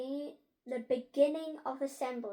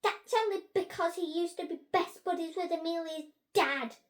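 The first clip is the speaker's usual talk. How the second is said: high, loud, jealous jibe; spiteful spitting; 6 semitones higher, 6 dB louder than usual. A narrator's reading. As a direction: spit it out resentfully. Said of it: "Frankie spat".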